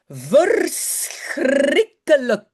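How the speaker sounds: verschrikkelijk drawn out very slowly, syllable by syllable; slow model word. Shown as a demonstration. Teacher modelling the full Dutch word for francophone learners, very slow. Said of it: "Ça nous donne".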